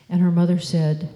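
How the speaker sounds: ordinary delivery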